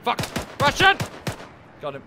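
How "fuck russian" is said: sudden loud high callout; alarmed shout. Real, not acted. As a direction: shout the warning. Spotting enemy mid-fight.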